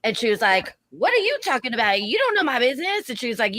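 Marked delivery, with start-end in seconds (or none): 0.9-3.1 high, indignant retort; shrill drunk comeback. Retold or imitated. Drunk customer defending herself, high and indignant. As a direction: snap back at the bartender, voice way up. Drunk woman snapping back.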